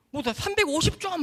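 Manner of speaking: high-pitched called-back answer; re-enacted high holler; catcher's reply. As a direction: toss the answer back across the field. Catcher calls back his score.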